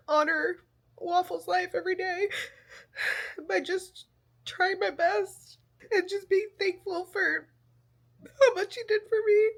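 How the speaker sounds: tearful, voice breaking, pitch high; speaking through real tears; crying. Real, not acted. Crying while honoring lost pet.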